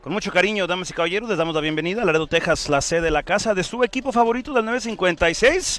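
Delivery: loud Spanish ring introduction; lucha libre announcer. Acted.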